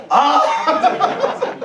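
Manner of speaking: loud laughing roar; hammed-up roar; mock big bear roar. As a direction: roar like the huge father bear. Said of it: "the father was doing it".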